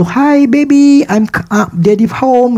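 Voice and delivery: put-on high, slow, doting baby talk to his Harley; cooing to the bike. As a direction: coo it like greeting a sweetheart. Cooing to his beloved motorbike.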